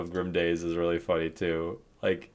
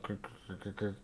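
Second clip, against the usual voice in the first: imitated radio chatter; 18 dB quieter, faster than usual. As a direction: imitate garbled talk with quiet growly grunts. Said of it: "like, talking".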